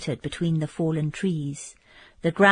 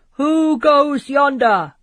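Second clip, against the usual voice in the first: slow, much louder and higher guard's call from the walls; sentinel's challenge; 8 semitones higher, 8 dB louder, slower than usual. Acted. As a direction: hail a stranger from the ramparts. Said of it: "cried a sentinel of the castle".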